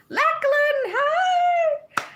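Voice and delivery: very high, loud happy greeting; excited squeal. Real, not acted. Greeting a viewer in Australia with a squeal. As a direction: squeal hello across the world.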